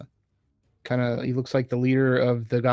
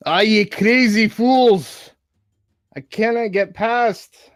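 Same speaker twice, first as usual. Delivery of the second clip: high, slow, loud gruff dwarf voice; growling dwarf; 10 semitones higher, 7 dB louder, slower than usual. Acted. Dwarf growling at the fools blocking the hallway. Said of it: "Duradin growls from the back".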